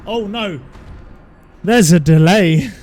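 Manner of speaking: loud, drawn-out panic; panicked outcry. Real, not acted. Gamer realising the input delay, panicked loud outcry. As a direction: cry out in alarm as it lags.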